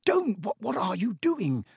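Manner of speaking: high, alarmed hiss; understated panicky stammer. A narrator's reading. Harry stops the elf banging his head, hissing. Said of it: "Harry hissed".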